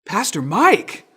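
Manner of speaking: high, loud startled call of a name; the kid spotting his pastor, caught off guard; a teen blurting out his pastor's name in surprise; surprised greeting. Retold or imitated. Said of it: "And he goes".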